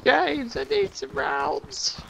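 high, slow whining plea; whiny begging voice. Pretend. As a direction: beg like a needy kid. Whining for ammo, mock voice.

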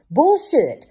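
high, loud outburst; sudden high-pitched shout; crying out. A narrator's reading. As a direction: cry it out in a voice unlike your own. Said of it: "cried out in a voice that did not sound like his own".

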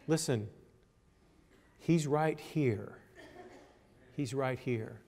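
very soft, slow reassurance; hushed. Real, not acted. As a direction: let it drop almost to nothing, with space between phrases. Hushed answer after the shouted question.

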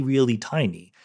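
ordinary talk

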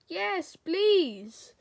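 usual speaking voice

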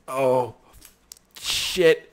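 ordinary delivery

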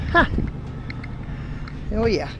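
ordinary talk

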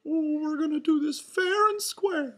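high, loud falsetto announcement; Mickey Mouse announcing. A narrator's reading. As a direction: take charge as a cartoon mouse. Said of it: "Mickey announced".

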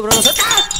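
loud sudden shriek of fright; startled scream. Real, not acted. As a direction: cut off by a jolt of fear. Jump scare mid-sentence.

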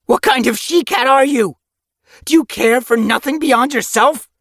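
screech. Acted. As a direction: screech it at her. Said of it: "His voice rose to a screech".

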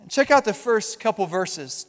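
ordinary talk, nothing marked

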